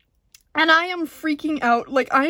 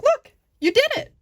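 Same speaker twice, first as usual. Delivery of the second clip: proud brother; 12 semitones higher, 4 dB louder than usual. Retold or imitated. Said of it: "my brother's like".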